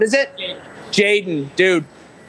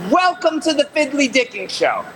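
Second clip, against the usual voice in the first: lively show-host line, a little higher; announcer demo; 7 semitones higher than usual. Shown as a demonstration. Host coaching a stranger, upbeat announcer read. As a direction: pitch it like a show intro. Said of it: "What I need you to say is".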